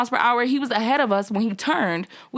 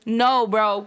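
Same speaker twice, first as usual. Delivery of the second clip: ordinary delivery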